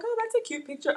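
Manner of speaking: very high cooing voice; soft, high-pitched little admiration; pleased self-quote. Retold or imitated. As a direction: coo lightly over a photo. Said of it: "So I was like".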